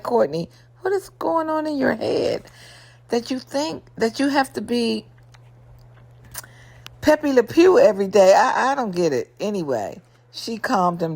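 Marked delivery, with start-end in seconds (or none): 0.0-8.3 high, baffled what-is-going-on; mock incredulous question. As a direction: ask her in amused disbelief. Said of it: "Now I'm like".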